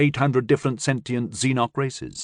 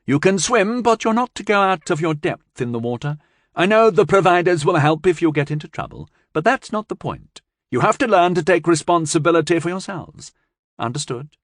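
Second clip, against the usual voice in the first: a hint of a scolding grandmother; mildly stern old woman warning the children; lightly voiced stern old woman; 7 semitones higher than usual. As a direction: lay down the rules firmly. Tracy lectures children.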